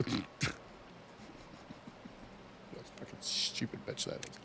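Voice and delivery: hushed muttered insult; whispered aside. Real, not acted. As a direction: say it under your breath, unvoiced. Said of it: "muttered aside under breath".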